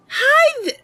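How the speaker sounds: put-on very high, bright girlish character voice; perky Mercy voice. Mercy greeting a stranger brightly, very high and cheery. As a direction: play her as a cheerful young cat.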